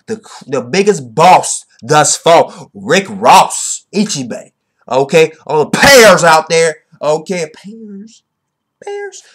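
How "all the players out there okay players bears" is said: loud, slow hype call; hype shout-out. Real, not acted. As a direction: call it out to the crowd.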